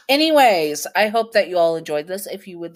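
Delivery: high, loud, quick sign-off; bright outro. Real, not acted. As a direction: cheerfully wrap up the story. Outro, back to own chat.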